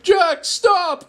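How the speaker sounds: high pleading yell; scream. Acted. Narrator begging Jack to stop the meatballs, high yell. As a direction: cry out for him to stop. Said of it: "I screamed".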